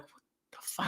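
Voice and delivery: breathless whispered what the fuck; groggy whisper in the dark. Retold or imitated. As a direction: hush it, half awake and straining to hear. Waking at night in a daze.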